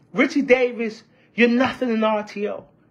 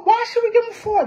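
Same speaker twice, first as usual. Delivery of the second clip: high-pitched mock haggler; mock objection; 9 semitones higher, faster than usual. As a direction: object in a raised, put-on pitch. Imagined officials haggling over payout.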